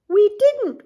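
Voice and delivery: high, loud squeaky bat. Acted. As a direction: snap it like a tired bat. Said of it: "replied a bat".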